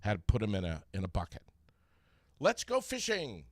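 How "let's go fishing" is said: high, drawn-out cheery call; rally everyone for an outing, stretching it; acted-out enthusiasm, pitched up; eager announcement. Acting out eager start of trip.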